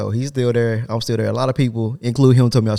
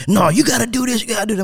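put-on higher, pushy voice of the old coworker; imitating the nagging coworker, pitched up; mock boss nagging; 11 semitones higher than usual. Boss voiced in retold argument.

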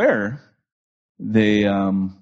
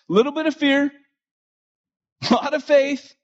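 much higher impassioned sermon build; rising preaching; 14 semitones higher than usual. Acted. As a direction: preach the list, climbing each line. Preaching the point of adventure.

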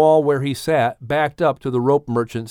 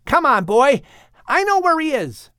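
urging the horse; 12 semitones higher than usual. Acted. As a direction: spur him on eagerly.

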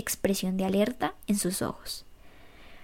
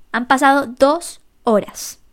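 loud, slow, higher accusing line; vehement; 4 semitones higher, 9 dB louder, slower than usual. A narrator's reading. Gaby confronting Jerry about his lateness, loud vehement anger. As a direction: hurl each word at him. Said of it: "cada palabra sonara con vehemencia".

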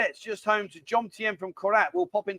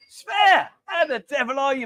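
calling out; 6 semitones higher than usual. Real, not acted. Host hailing a viewer by name, high shout.

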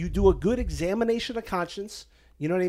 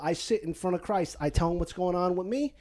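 ordinary delivery

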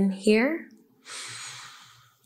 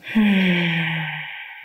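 audible sigh. Acted. Deep breath in meditation.

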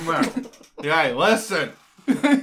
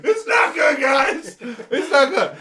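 high pained whine, laughing; mock sick whine; 8 semitones higher, 5 dB louder, slower than usual. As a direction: whimper it like you regret eating.

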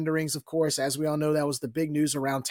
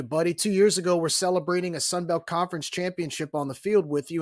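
ordinary talk

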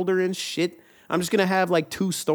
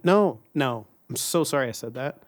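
normal talking voice

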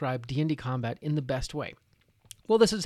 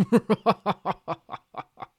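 loud mocking orc laugh; hammed-up brutish belly laugh; 4 semitones higher, 4 dB louder than usual. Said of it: "The orc begins to laugh".

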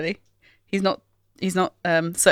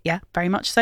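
ordinary talk, nothing marked

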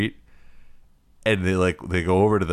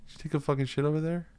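quiet, fast, higher cop demand; no-nonsense cop voice; 6 semitones higher, 8 dB quieter, faster than usual. Retold or imitated. Cop who has no time for it, quiet and stern. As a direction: grill the suspect quietly. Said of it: "he's also no nonsense".

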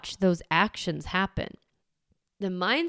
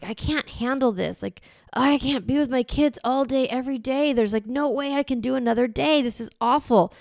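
overwhelmed; 6 semitones higher, 3 dB louder than usual. Retold or imitated. Voicing an overwhelmed parent's thoughts.

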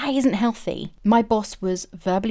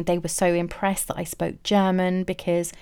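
usual speaking voice

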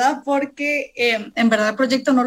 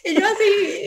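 laughing; 9 semitones higher than usual. Real, not acted.

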